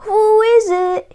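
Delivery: a wolf playing granny; sweetest Granny voice. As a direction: fake the grandmother sweetly. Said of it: "in his sweetest Granny voice".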